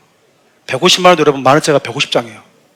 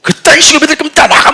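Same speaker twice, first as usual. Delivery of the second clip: high, loud furious get-out shout; sharp angry preacher; 10 semitones higher, 4 dB louder than usual. Retold or imitated. Young pastor driving out lukewarm believers, angry high shout. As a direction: blast the congregation like a fiery young preacher. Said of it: "진짜 날카로웠습니다".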